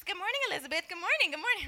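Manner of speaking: high, loud, chirpy repeated hello; happy greeting. Retold or imitated. The coworker, now happy, greets her cheerily. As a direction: imitate a newly friendly greeter. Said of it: "now she's happy".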